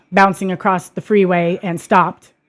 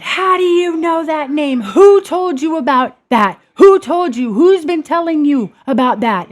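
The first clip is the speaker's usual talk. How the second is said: angry mom; 8 semitones higher, 4 dB louder than usual. Retold or imitated. Atheist mother grilling her toddler about Jesus, angry. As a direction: demand it like a furious, suspicious parent. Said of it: "She got very angry with me".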